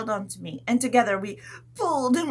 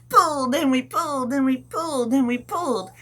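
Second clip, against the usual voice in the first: louder, higher heave chant; straining pull; 5 semitones higher, 6 dB louder than usual. Pretend. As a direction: heave on every pulled.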